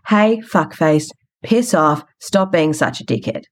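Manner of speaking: ordinary talk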